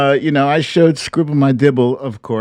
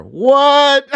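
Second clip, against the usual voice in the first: high, loud surprised What; startled outcry; mock shock; 13 semitones higher, 4 dB louder than usual. Real, not acted. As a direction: yelp it in disbelief. Shocked at the age counter.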